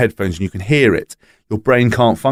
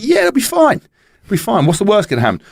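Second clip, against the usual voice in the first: mock breezy confidence; 10 semitones higher than usual. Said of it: "I was like".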